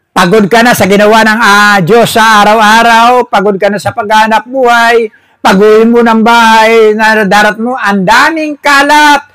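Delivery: a weary member griping to the pastor; complaining churchgoer. Pretend. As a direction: whine it out like a tired parishioner. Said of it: "Maring sabihin mo".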